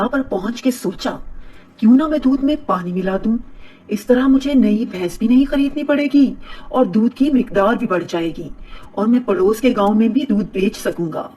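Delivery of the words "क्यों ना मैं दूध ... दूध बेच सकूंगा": ordinary delivery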